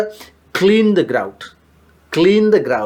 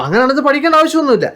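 teasing reply; 11 semitones higher, faster than usual. Real, not acted. Answers student's joke about cars.

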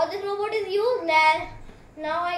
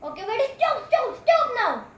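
raised voice, higher and louder; loud stop; 5 semitones higher, 4 dB louder than usual. Acted. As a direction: call out to make it stop. Shouting at robot to stop.

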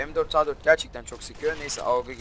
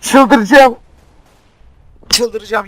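real high-pitched furious scream; losing it; 9 semitones higher, 19 dB louder, much slower than usual. Real, not acted. Gamer swearing he will go crazy after dying, losing it. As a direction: scream it out, at breaking point. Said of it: "yemin ederim çıldıracağım".

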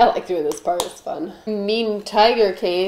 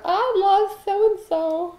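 much higher, slow dreamy voice; schoolgirl crush; 11 semitones higher, much slower than usual. Retold or imitated. Writing a crush's name in class, dreamy high voice. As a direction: sigh it like a lovesick kid. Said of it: "You doodle on your paper".

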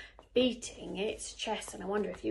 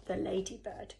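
ordinary delivery, nothing marked

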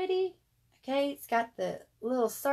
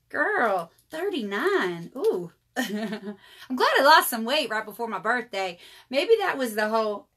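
ordinary delivery

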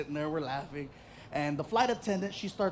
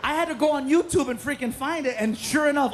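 normal talking voice

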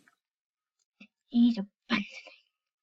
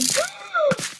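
gasp. Real, not acted. Shot by arrows.

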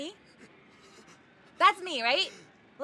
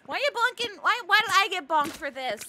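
indignant protest; 7 semitones higher, 3 dB louder than usual. Real, not acted. Indignant at getting bonked.

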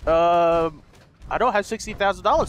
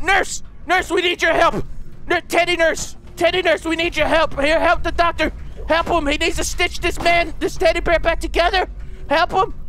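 high, urgent mock-emergency calls; frantic plea; 7 semitones higher than usual. Player begging teddy nurse to help the doctor, frantic plea. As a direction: summon help in melodramatic panic.

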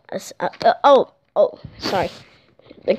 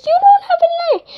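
squeaky high; faster than usual. Pretend. Operator squeals at Thomas.

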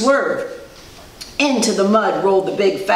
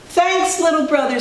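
higher-pitched thanks; grateful dump truck; 7 semitones higher than usual. A narrator's reading. Dump thanking Little Blue, higher voice. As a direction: thank your small helper from up high. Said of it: "said Dump to Blue".

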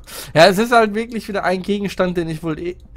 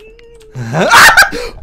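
high startled laugh after a jumpscare; shrieking laugh; 12 dB louder than usual. Real, not acted. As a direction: burst out laughing in shock. Jumpscare reaction.

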